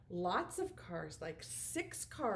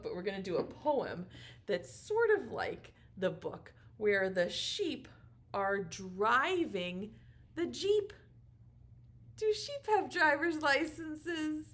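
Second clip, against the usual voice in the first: ordinary talk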